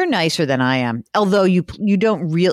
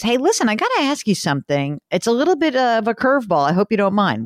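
higher, eager radio anchor voice; imitating the anchor; 7 semitones higher than usual. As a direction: ask it like a curious anchor. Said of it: "he goes".

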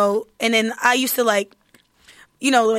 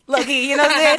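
ordinary talk

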